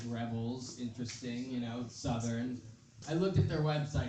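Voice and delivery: ordinary talk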